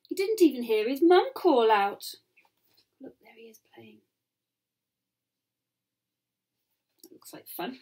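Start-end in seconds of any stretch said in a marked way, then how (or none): 3.0-7.8 hushed aside to the listeners; soft unvoiced storytime aside; whisper. Real, not acted. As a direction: whisper it like sharing a secret.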